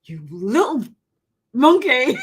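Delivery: playful telling-off breaking into laughter; drawn-out, affectionate fake outrage; mock scolding. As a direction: scold a cheeky child, then laugh. Mock scolding her cheeky son, laughing.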